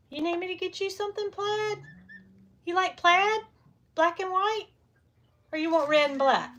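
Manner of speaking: high, sweet pet talk. Real, not acted. Asking the goose about plaid in high baby talk.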